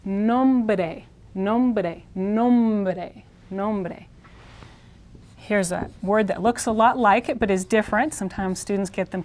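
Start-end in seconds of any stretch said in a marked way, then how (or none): 0.0-4.0 "nombre" drawn out as nohm-b-deh, r tapped; careful Spanish pronunciation. Shown as a demonstration. Teacher shows consonant and r combination, slow careful pronunciation. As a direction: model "nombre" repeatedly, stretching the m, quick tap after b. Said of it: "So this word is pronounced".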